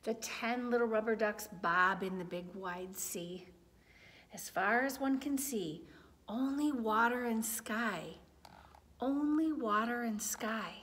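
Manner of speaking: gentle storybook narration, lots of tune; reading to children. A narrator's reading. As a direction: read it like bedtime to little ones. Reading a picture book aloud.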